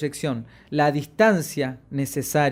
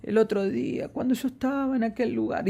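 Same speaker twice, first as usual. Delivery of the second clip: softer and higher, as if about to cry; mock trembling voice; 5 semitones higher, 6 dB quieter than usual. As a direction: imitate a speaker on the verge of tears. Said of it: "parece que van a llorar". (imitando una voz temblorosa, casi llorando)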